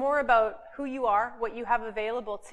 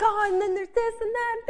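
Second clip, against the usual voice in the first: excited; 9 semitones higher than usual. Retold or imitated. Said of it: "I'm like".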